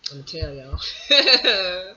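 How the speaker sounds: very slow, laughing through it; giggling slowly. Real, not acted. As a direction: dissolve into laughter on "y'all". Laughs at own tangent.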